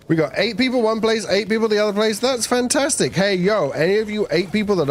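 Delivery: excited. Real, not acted. Excited stream chatter to viewers.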